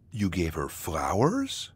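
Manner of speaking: slow question, rising sharply on "flowers"; stress on FLOWERS. Shown as a demonstration. Narrator showing how stress changes meaning, stress on "flowers". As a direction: punch "flowers" at the end as a question. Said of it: "By changing the stress, you change".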